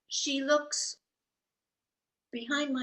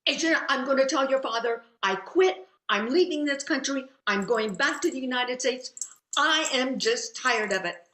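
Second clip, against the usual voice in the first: loud, emphatic retold rant; fed-up venting, pushed out hard; angry outburst; 3 semitones higher, 5 dB louder than usual. Mother ranting to daughter about quitting the country, loud and angry. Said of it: "I was so angry".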